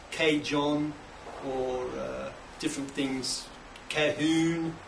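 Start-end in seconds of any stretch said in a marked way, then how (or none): 0.1-0.9 cajon said KAY-jon, with English J; mispronounced cajon. Shown as a demonstration. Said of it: "a lot of people pronounce it as". 3.9-4.7 cajon said ka-HOON, long oo ending; mispronounced cajon. Shown as a demonstration. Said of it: "different things like that".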